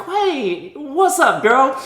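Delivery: high-pitched mock audience voice; drawn-in audience, voiced high; puzzled audience. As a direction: lilt it up high, imitating baffled viewers. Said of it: "the audience is like".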